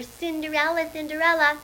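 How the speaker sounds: teasing sing-song name-calling; mocking sing-song taunt. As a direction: chant the name like mean stepsisters. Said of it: "like that".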